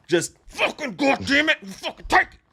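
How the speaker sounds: mock brutal attacker. Acting the killer's attack.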